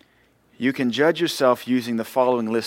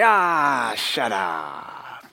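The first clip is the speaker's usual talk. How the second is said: grumpy bulldog; much slower than usual. Retold or imitated. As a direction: growl it like a grumpy old bulldog. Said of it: "he'd smack him and say".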